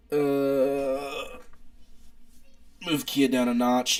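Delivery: ordinary talk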